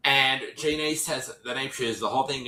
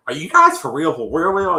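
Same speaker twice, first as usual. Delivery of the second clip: excited disbelief; 5 semitones higher, 9 dB louder than usual. Retold or imitated. Red answering the Catfish call.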